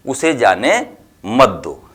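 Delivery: ordinary talk, nothing marked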